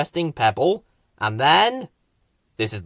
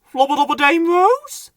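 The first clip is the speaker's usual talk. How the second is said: higher, louder hopeful gibberish; higher hopeful babble; gibberish question; 6 dB louder than usual. Acted. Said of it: "he asked".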